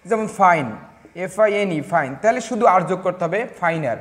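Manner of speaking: normal talking voice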